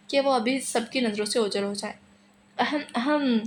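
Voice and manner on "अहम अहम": mock cough to tease the bride; a playful hmm-hmm clearing of the throat; cheeky ahem; teasing fake throat-clear. A narrator's reading. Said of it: "शरारत से बोली".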